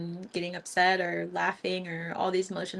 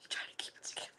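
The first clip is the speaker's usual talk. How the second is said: whisper; 15 dB quieter, much faster than usual. Real, not acted. Whispered repeat of own line.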